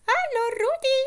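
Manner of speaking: very high tiny doll voice. Acted. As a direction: squeak it like a talking doll. The doll greets Rudi.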